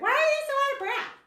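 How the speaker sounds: high, loud scoffing disbelief at the instructors; mock the gasping teacher with a sneer; judgmental mockery. Judging a video instructor, high loud scoffing. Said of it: "I'm like".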